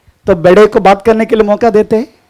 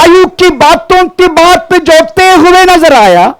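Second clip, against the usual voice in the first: preaching repeat; 8 semitones higher, slower than usual. Acted. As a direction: proclaim the verse back slowly. Preacher repeats reading loudly. (ऊँची, धीमी, ज़ोरदार प्रचार शैली)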